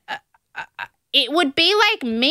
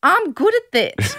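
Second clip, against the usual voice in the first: put-on South African accent; 8 semitones higher than usual. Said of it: "my South African Airlines accent is good".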